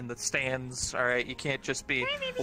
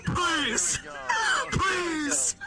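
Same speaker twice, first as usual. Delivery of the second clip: from pleading to crying out much higher; escalating plea; 13 semitones higher, much slower than usual. Acted. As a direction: beg harder each time until it breaks into a wail. Begging, rising to a cry.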